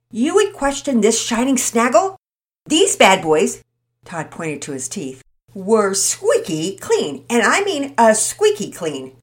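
put-on loud, showy kid voice; boastful boy. Todd bragging to Mama Peters about his teeth, loud and showy. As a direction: show off like a proud goofball.

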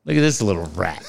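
mock a rival in a light Irish accent; Conor McGregor. Host quoting the coach's favorite insult, light Irish accent. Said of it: "Conor would say in that accent".

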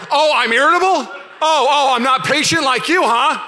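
loud, high mock outrage; hammed-up high, loud indignation; indignant comeback. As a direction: imitate an offended wife firing back. Wife snaps back, acted.

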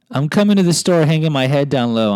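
ordinary talk, nothing marked

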